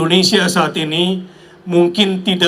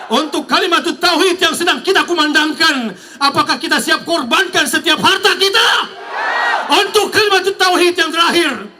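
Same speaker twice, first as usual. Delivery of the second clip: rally speech; 5 semitones higher than usual. Acted. Rally call-and-response to crowd.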